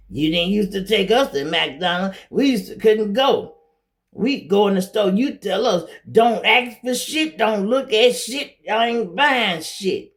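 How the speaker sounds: mock the grown kids griping about the past; complaining kids. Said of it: "And the kids be like".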